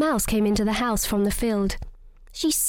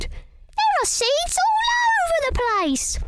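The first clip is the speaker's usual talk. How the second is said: mouse crying out. Acted. Missus Mouse finds the messy squash, high dismayed cry. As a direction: exclaim in tiny dismay.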